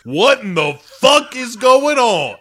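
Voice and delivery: high, loud mock trucker yell; retold yell. Podcast host quoting a trucker in the movie, loud mock yell. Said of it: "and yells".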